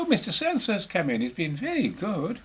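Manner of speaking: high, posh-sounding mock Queen voice; mimicking the Queen. Imitating the Queen welcoming the intruder, high and gracious. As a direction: play the Queen, all gracious surprise.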